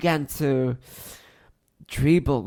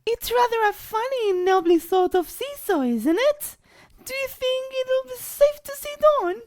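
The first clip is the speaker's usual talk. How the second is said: put-on very high, prim girl's voice; older girl; 5 dB louder than usual. A narrator's reading. Older girl asking the others about safety, prim. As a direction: ask it like a careful older girl. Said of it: "a girl who was older".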